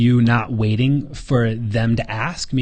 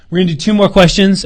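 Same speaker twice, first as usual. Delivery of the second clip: ordinary delivery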